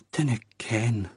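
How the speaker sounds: muffled, strangled croak. A narrator's reading. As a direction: croak it out into your arms. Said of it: "emerged in a strangled croak".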